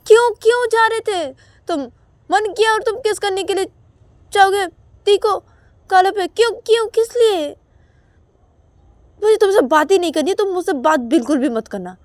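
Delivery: high, loud, fast angry refusal; angry hurt outburst. Acted. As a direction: snap and refuse to talk. Ravi angry, sulks.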